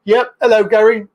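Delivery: ordinary talk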